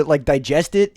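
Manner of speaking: ordinary delivery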